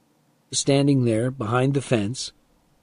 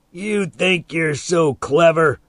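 slow, higher-pitched scornful taunt; sneering; 6 semitones higher, 3 dB louder, slower than usual. A narrator's reading. Man approaches fence to scold boy, sneering. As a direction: draw it out with scorn.

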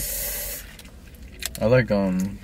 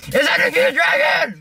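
high slow mocking squeal; imitating her; 14 semitones higher than usual. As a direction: mimic her starstruck squeal. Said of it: "I'll give you a representation of what she said".

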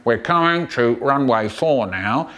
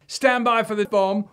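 ordinary delivery

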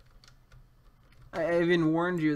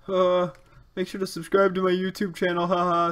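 lightly hammed-up YouTuber plug; mock YouTuber; 3 semitones higher, 5 dB louder than usual. Gamer parodying a YouTuber plug in a silly voice.

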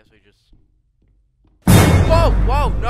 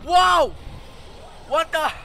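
scared horror game streamer; 12 semitones higher, 5 dB louder than usual. Real, not acted.

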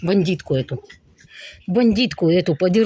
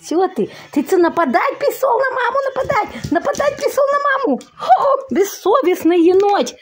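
squeaky high mock scolding; shrill nagging grandma; 12 semitones higher than usual.

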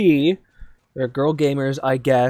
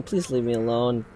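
usual speaking voice

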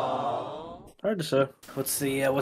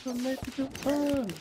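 sing-song high voice; 8 semitones higher, 7 dB quieter, slower than usual. Acted. Sing-song mock optimism.

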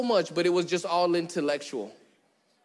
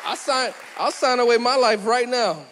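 loud, high, overeager; hammed-up rush to sign away his life; mock eager sign-up; 4 semitones higher, 3 dB louder than usual. Preacher mocking an eager signer, loud and keen.

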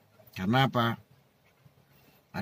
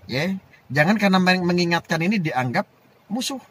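raised, insistent orator's pitch; impassioned oratory; 8 semitones higher, 5 dB louder than usual. Real, not acted. Explaining why he warns the respected habaib, raised impassioned oratory. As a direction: plead the case high and emphatically. Impassioned defence of his criticism.

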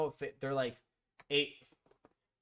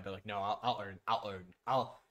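stumbling over words; 6 semitones lower than usual. Real, not acted. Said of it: "I'm stumbling over my words".

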